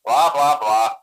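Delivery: loud, bored brush-off; dismissive blah blah. Acted. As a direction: wave off the fine print out loud. Skipping the legal disclaimer.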